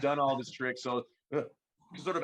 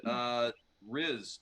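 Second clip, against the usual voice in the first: ordinary talk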